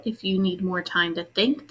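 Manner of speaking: ordinary delivery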